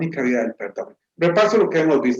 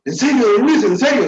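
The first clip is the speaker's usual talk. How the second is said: high, louder pleading with a laugh; hopeful disbelief; 7 semitones higher, 3 dB louder than usual. Retold or imitated. Boy asking neighbor if he'll really help, hopeful. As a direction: grab at the hope.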